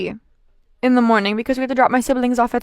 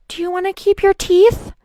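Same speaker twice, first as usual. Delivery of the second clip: higher nurse voice asking kindly; 9 semitones higher, 3 dB louder than usual. Retold or imitated. Nurse leaning over the drowsy patient, higher and light. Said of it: "And she goes".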